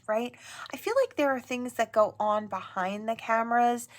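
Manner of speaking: ordinary delivery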